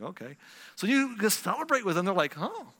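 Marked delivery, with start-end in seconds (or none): 0.0-0.4 subdued reply. Acted. Avoided coworker's subdued reply. 2.4-2.7 high, soft "oh" of surprise; pleasant surprise. Acted. Coworker disarmed by being congratulated, softly surprised.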